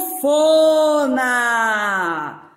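"sanfona" drawn out, syllable by syllable; slow, stretched model word. Shown as a demonstration. Teacher naming the picture for the children: slow, drawn-out "sanfona". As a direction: stretch "sanfona" so every sound is heard.

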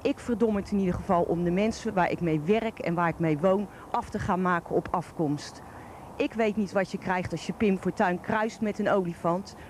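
ordinary talk

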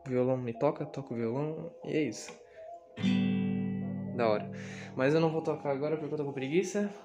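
normal talking voice

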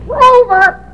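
dummy voice. Acted. Ventriloquist dummy voice.

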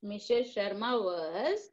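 slow, drawn-out dictation with a rise on was; slow teacher dictation. Shown as a demonstration. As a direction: spell it out slowly for the class. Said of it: "ये जो है question में ही है".

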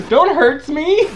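high, loud mock-frightened plea; mock pleading. Co-host begs gamer threatening to knock off puns, mock pleading. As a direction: squeal it in fake panic through giggles.

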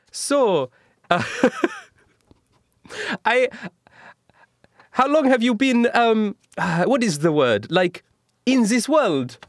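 put-on high, hammy Italian accent; casual chat in a bad Italian accent. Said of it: "Leonardo da Vinci is just casually talking".

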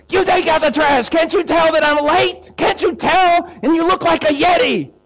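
high, loud hammed-up outraged yell; angry shout. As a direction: scream it back, totally justified. Husband yells back again.

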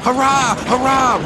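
very high, gleeful hurrah; roaring cheer. A narrator's reading. Runaway cars celebrate their trick, roaring cheer. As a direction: cheer twice at a high pitch. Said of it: "roared the cars".

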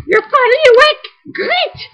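very high squeaky put-on voice; terrible mocking voice. Gamer voicing a game character, terrible high squeaky voice. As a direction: squeal it in a deliberately bad falsetto. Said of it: "I'm doing my terrible voice".

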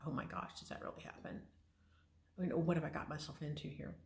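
breathless whisper of a worried thought; whispered inner panic. Retold or imitated. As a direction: hush it, as if only thinking it. Panicked thought during the biopsy.

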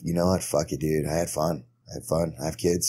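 low and spent, shrugging it off; Charlie Sheen impression. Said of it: "He's just like".

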